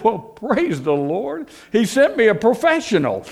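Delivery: mock-gushing church lady thanking heaven; delighted mother. Mother thanks the young ex-convict, lightly voiced high and delighted.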